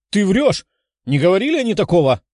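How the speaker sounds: outraged, quickening accusation; indignant exclamation. A narrator's reading. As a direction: deny it hotly and quickly. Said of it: "воскликнула красноволосая".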